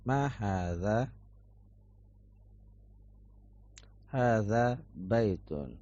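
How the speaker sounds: slow, clear drill reading for students to copy; model for repetition. Shown as a demonstration. Teacher leading a repeat-after-me drill, slow and clear. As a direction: say each phrase slowly so the class can repeat it. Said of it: "antum mengulangi apa yang ana ucapkan".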